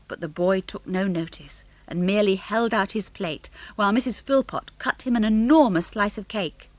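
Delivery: ordinary delivery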